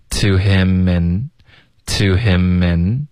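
"to him in" slowly, m running into "in"; linked words. Shown as a demonstration. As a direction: join "him in" as one word, "himmin". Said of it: "him跟in会连读".

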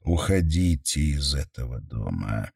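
low, subdued warning; grave, restrained urging to leave; inner warning voice. A narrator's reading. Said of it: "остерёг внутренний голос".

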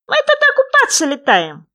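high, loud, squeaky little frog; small creature's high, loud voice. A narrator's reading. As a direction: pipe up eagerly as a tiny frog. Unseen frog boasts.

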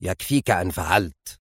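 low, soft severity; quiet, controlled sternness; stern. A narrator's reading. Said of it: "قال ويليام في صرامة".

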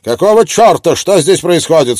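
high, loud furious yell. Acted. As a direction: storm in raging. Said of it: "в глазах ярость".

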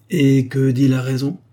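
ordinary delivery, nothing marked

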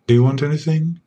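ordinary talk

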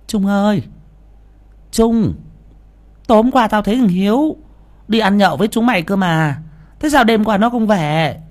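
put-on high, calling old woman's voice; worried mother. Mother asks Trung where her son is, high and worried. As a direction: call out anxiously to the boy.

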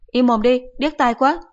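high, loud irritated snap; a mother telling her son off; shrill-free loud mother scolding. Acted.

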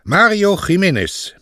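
name given high and proud; solemn. A narrator's reading. Mario stating his name to the postmaster, solemnly. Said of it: "antwortete Mario feierlich".

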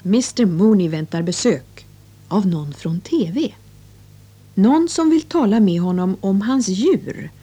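ordinary talk, nothing marked

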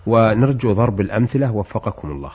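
formal standard arabic. Acted. Host reads listener's letter.

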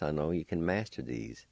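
usual speaking voice

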